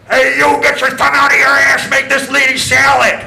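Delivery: loud yell through the kitchen door; a shouted kitchen command; yelled order. Retold or imitated. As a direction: bellow it at the cooks. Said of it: "he yelled".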